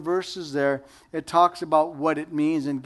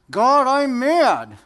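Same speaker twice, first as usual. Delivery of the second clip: honest anger; 7 semitones higher, 7 dB louder, slower than usual. Pretend. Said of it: "How about saying".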